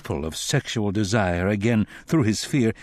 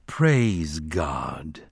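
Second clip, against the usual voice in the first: low, solemn congregational response; 6 semitones lower than usual. A narrator's reading. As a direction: intone it like a drained congregation. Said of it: "spoke it solemnly".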